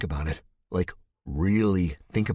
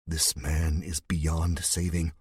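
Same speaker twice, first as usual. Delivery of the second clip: usual speaking voice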